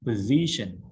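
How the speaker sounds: position with the S said as Z. Shown as a demonstration. Teacher explaining to students, S said as Z. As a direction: say position with a z sound, stress on zi. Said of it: "S I here pronounce Z".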